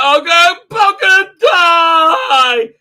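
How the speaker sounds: very high, loud mock wailing shout; hammed-up hysteria; captain shrieking hysterically, far from cool; hysterical cry. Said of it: "hardly Shatner, cool and collected".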